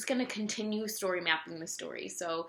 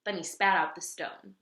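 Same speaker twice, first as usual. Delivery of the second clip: plain reading voice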